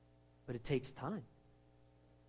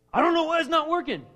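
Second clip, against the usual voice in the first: whiny complainer; 12 semitones higher, 9 dB louder than usual. Retold or imitated.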